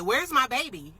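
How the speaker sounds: high, panicked retelling; frantic searching. Retold or imitated. Mother reliving a Lifetime movie in her head, frantic.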